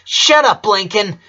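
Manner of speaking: angry outburst. Acted. Lynn cuts off her brother, loud angry outburst. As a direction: shout him down. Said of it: "she blurted".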